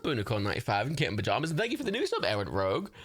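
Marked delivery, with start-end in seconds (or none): none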